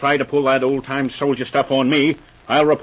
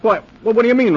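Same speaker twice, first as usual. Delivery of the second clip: startled demand; 10 semitones higher, 6 dB louder, much faster than usual. Acted. Inspector startled by news.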